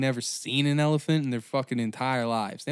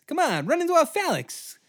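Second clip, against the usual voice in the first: even higher villain voice on repeat; old-timey villain taunt, pushed; 14 semitones higher than usual. Retold or imitated. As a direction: push the taunt harder the second time. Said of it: "I love that voice".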